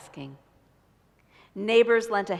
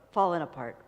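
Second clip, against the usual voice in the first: ordinary delivery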